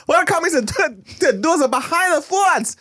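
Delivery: very high, badly faked Chinese accent; Chinese American accent. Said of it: "in a Chinese American accent".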